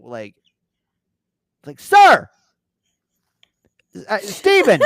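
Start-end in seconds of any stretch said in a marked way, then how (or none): 1.8-4.8 high, loud call of a name; mock attendant shouting his name; yelling attendant. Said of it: "they're yelling so loud at me".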